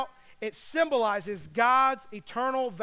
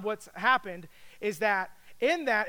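ordinary talk

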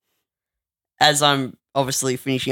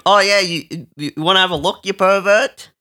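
higher, louder pretend retort; pretend shout; 6 semitones higher, 5 dB louder than usual. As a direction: snap back at the peeping stranger. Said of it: "I should have said to him".

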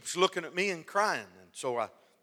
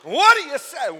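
high, loud comic exclamation; mock outburst; 10 semitones higher, 14 dB louder than usual. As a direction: throw it out as a raised yell.

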